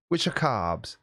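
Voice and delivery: usual speaking voice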